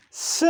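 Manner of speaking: S as "suh", not the letter name; isolated "suh" for S; unvoiced consonant demo. Shown as a demonstration. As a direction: sound out S with a short vowel.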